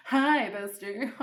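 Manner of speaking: slow, wobbly greeting with a laugh in it; drawn-out hi, half laughing, half crying; mock-fragile greeting. Caller greets Flight Centre about her mistake, mock-fragile. Acts tearful call to agent.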